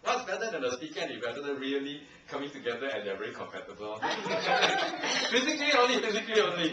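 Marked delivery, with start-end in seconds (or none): 4.0-5.2 short breathy laugh. Real, not acted. Amused laugh at own joke.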